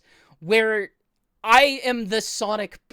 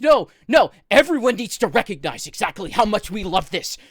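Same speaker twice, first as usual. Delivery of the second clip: loud, hammered-out mock fan demands; insistent Sonic fans; 6 dB louder than usual. Ranting about Sonic fans to friends, mock-insistent fan voice. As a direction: mimic fans insisting at full volume. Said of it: "Sonic fans seem to insist that".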